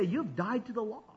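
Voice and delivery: softly, almost under the breath; hushed. Shown as a demonstration. Preacher leading the crowd in a hushed line. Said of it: "Oh, we have to whisper it".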